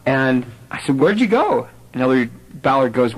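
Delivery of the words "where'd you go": eager question. Retold or imitated. Retold own eager question.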